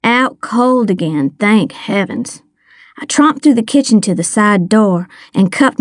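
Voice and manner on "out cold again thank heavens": plain reading voice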